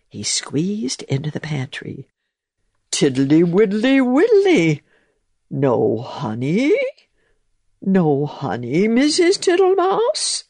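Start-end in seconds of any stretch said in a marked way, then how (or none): 2.9-10.4 sing-song toad, rising high on the question; puzzled toad. A narrator's reading. Toad asking the mouse where the honey is, sing-song and puzzled. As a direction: hunt for honey, disappointed.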